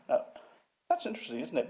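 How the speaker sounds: high-pitched donnish musing, imitated; David Wilson. Museum head pondering the raven bones, imitated high and lofty. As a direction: put on a lofty, high critic's voice. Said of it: "he is a sneerer".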